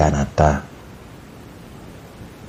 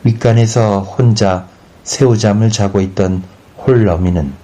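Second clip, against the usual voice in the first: ordinary delivery, nothing marked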